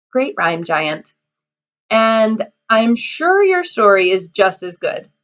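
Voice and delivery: ordinary delivery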